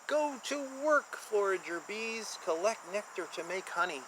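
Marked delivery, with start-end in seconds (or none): none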